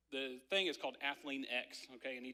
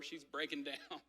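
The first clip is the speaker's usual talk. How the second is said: ordinary delivery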